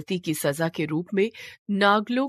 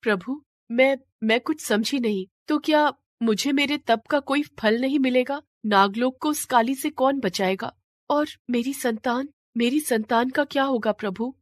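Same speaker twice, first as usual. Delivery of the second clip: higher, worried questioning; anxious plea; 6 semitones higher than usual. Acted. Shivalika asks Shiva about her child, worried and pleading. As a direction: fear for her unborn child.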